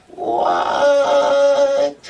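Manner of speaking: high, loud, long-held answer; hollered reply. Acted. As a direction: holler back in a drawn-out singsong. Igor hollers back to girlfriend.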